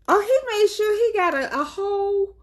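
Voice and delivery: high, stretched shocked exclamation; shocked squeal. Real, not acted. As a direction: squeal it out in disbelief. Shocked retelling of son's act.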